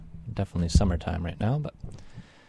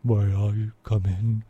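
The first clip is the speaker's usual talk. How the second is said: polite frog. Acted. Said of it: "with a polite nod, he asked".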